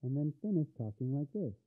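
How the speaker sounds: quiet, quick voice through a filter. Shown as a demonstration. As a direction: finish the line as the filter thins it out. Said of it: "dip to a little bit more bassy".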